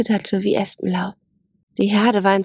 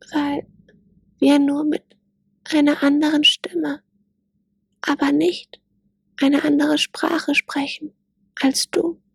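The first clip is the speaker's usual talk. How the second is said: higher, timid little fawn voice; timid gazelle fawn; 7 semitones higher than usual. A narrator's reading.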